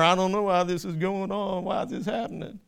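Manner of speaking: mock whining, climbing high and loud; whiny complainer. Preacher mocking a whining believer, high hammed-up whine. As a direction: ham up a self-pitying believer crying to God. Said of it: "We ever whine and complain and murmur".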